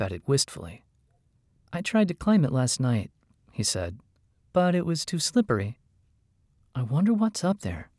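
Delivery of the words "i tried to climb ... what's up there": higher-pitched, wistful little character voice; wistful kender. A narrator's reading. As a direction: wonder aloud wistfully in a higher voice.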